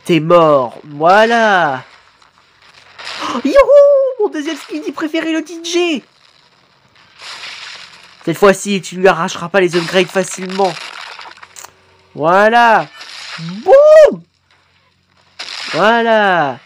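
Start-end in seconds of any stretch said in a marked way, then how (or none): 3.2-6.0 joyful whoop. Real, not acted. Cheers favourite Skibidi appearing. 13.4-14.2 loud high boum like an impact; boum sound effect. Real, not acted. Punctuates a hit in video.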